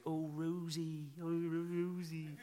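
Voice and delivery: high, slow, soft lovesick West Country sigh; dreamy West Country yokel pining for Rosie; Sam's West Country accent. Retold or imitated. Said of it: "Sorry, I did do that in the end".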